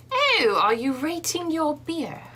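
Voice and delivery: hammed-up English accent, high and slow; pronounced English accent. Shown as a demonstration. Co-host showing how the Englishwoman asked, exaggerated English accent. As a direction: lay the English accent on thick, drawn out. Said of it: "That's very pronounced".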